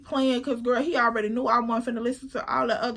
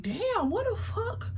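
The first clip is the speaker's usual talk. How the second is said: surprised; 10 semitones higher, 3 dB quieter than usual. Retold or imitated. Surprised at long drive.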